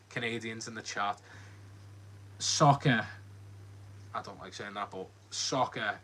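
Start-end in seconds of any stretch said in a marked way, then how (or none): none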